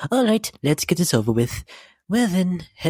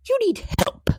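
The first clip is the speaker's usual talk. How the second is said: shocked high pitch. Acted. Ending scolding message to Pinkamena.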